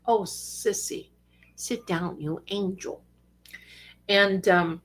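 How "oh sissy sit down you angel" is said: tender, soft coaxing. Real, not acted. Soothing the cat.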